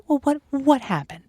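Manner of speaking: ordinary talk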